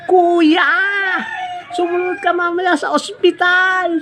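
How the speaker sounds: high, loud woman's call. Retold or imitated. Woman in ambulance calling to the man, high. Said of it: "Sabi nung babae".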